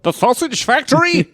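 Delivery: put-on high jokey German accent. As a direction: ask it in a hammy German accent. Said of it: "my, uh, German accent".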